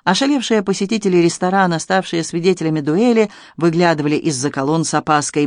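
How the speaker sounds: plain reading voice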